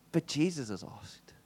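softly, slowly, to himself; hushed conviction; quiet and slow. Retold or imitated. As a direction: let it sink in quietly.

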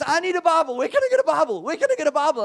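high, loud repeated urgent asking; desperate demand. Retold or imitated. Champion urgently demanding a Bible from the preacher. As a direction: plead like you can't wait another second. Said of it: "and he's".

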